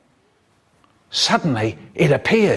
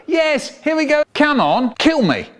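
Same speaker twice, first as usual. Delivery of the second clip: eager; 11 semitones higher than usual. Acted. Man eager to be killed.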